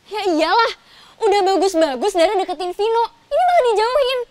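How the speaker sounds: testy, indignant retort; snappish. Acted. Friend grumbling about Dara being pushed from Vino, snappish. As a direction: snap back with rising annoyance. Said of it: "Kok lo nyolot sih?".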